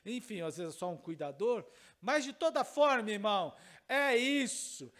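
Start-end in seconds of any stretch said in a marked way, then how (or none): 2.0-4.9 loud rising sermon climax; preacher building to a loud closing cry; preaching at full force. Acted. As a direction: drive the point home to the congregation.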